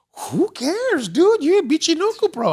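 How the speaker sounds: incredulous brush-off. Retold or imitated. Friend brushing off the ball costume, high incredulous retort. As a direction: scoff it off, pitch way up.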